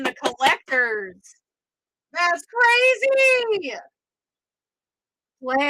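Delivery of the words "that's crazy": amazed. Real, not acted. Reacting to the rare pull.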